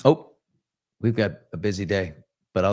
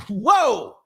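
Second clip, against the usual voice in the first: a higher, louder startled whoa; surprised whoa; 10 semitones higher, 4 dB louder than usual. Retold or imitated. Billy hearing Rudy wants eleven tickets, a higher startled whoa. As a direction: react to an absurd request.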